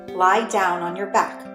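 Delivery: ordinary talk, nothing marked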